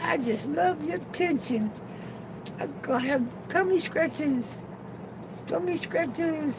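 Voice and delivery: tiny, high falsetto, softly put on; voicing the dog. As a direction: speak as a pleading dog, way up high. Voicing the dog's thoughts.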